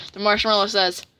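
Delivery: ordinary delivery, nothing marked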